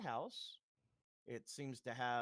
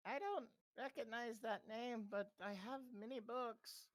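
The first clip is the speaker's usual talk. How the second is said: put-on old woman voice; 7 semitones higher than usual.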